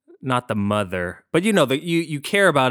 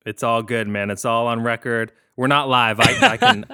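usual speaking voice

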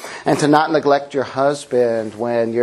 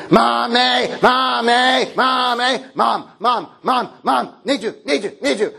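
high repeated calls for mommy; needy child calling; 8 semitones higher than usual. Retold or imitated. Pastor imitating a needy child pestering mom, high. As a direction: nag like a clingy toddler, higher pitch. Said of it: "changes into".

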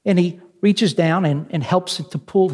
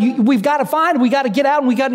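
frantic mock yell; 7 semitones higher, much faster than usual. Dad begging the guide to find his son, frantic. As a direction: imitate a frantic father, words tumbling out high. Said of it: "frantically, he's yelling at the guide".

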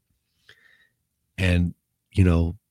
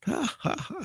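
short high mock cackle; imitated laughing fit; 6 dB quieter than usual. Lieutenant laughing after sending inmate to the hole, imitated laugh. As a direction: act out a quick burst of laughter. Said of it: "Starts laughing his ass off".

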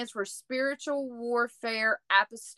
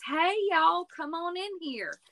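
imitated Texas hostess, pitched way up; Texas accent; 7 semitones higher than usual. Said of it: "a perfect Texas accent".

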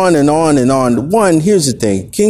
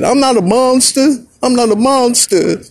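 high, pleading denial in a put-on voice; R. Kelly; 9 semitones higher than usual. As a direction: protest your innocence, pitched way up. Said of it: "This is what R. Kelly said".

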